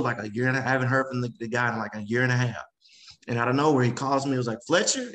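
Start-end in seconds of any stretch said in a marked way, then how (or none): 4.7-5.1 name called out high and eager; hyped caller greeting; excited call of a name. Retold or imitated. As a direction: sing out his name like big news. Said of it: "he's a fast-talking gentleman".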